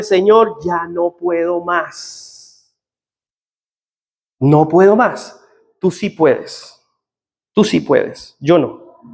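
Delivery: broken prayer. Retold or imitated. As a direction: pray it hushed and drawn out, almost giving up. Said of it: "oraciones sinceras y honestas diciéndole".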